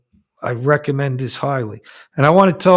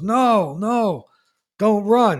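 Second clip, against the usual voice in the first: higher pleading voice, a mild dead husband's plea; pleading ghost; 8 semitones higher, 4 dB louder, slower than usual. Retold or imitated. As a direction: beg her to stay, pitched up. Ghost husband stops fleeing wife.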